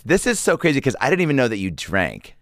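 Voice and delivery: a lightly voiced starstruck girl; girl at the bar. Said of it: "Ross Perot".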